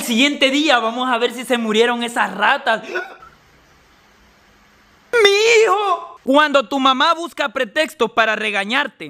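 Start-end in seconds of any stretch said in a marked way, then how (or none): 5.2-6.2 high, alarmed outcry; horrified cry. Acted. Parent finding the child after poisoning, horrified cry. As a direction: cry out in sudden horror.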